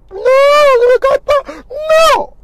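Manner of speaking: high, slow, loud wailing shout; crying shout. Retold or imitated. A sobbing caller yells at the pastor who tries to calm him. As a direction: refuse to be calmed, howling.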